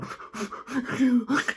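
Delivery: high mock whimper; put-on high, sobbing whimper; imitated whimpering. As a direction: whimper like a crying kid. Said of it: "he's whimpering".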